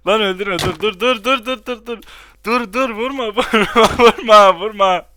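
high, loud panicked pleading; panicked yelling. Real, not acted. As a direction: yell at him to stop. Arkadaşı karakterini öldürmek üzere. (panik içinde bağırarak)

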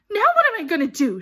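high, loud, fast wail; piercing, hurried outburst; shrill. A narrator's reading. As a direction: demand it shrilly, in a panic. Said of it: "she asked thr-, shrilly".

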